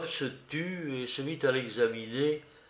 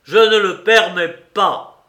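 very loud, slow, higher cry; furious repetition; 7 semitones higher, 15 dB louder than usual. A narrator's reading. Suspect repeats his refusal to the investigator, loud and stubborn. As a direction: hurl the refusal out, word by word. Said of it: "répéta Raskolnikov".

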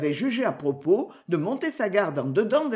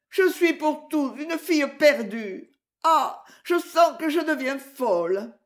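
higher-pitched anguished protest; mild wailing distress; 6 semitones higher than usual. A narrator's reading. Accused girl protesting to her listener, mildly distraught. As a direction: plead innocence in understated despair. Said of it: "Maud s'agenouilla en gémissant".

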